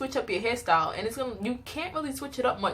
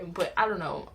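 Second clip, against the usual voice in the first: ordinary talk, nothing marked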